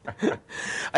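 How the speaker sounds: short burst of real laughter. Real, not acted. Host amused after congratulating guest, laughing. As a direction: break into a brief laugh.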